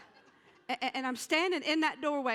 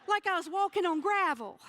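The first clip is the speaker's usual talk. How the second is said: louder, higher comic outrage; comic exasperation; 6 semitones higher, 6 dB louder than usual. Acted. Telling the audience about noisy pants, loud comic outrage. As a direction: play up the embarrassment loudly.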